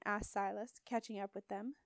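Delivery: usual speaking voice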